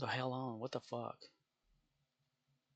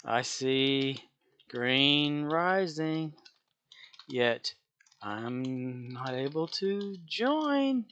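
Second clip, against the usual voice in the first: slow drawl; 3 semitones higher, much slower than usual. Real, not acted. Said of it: "reading game screen".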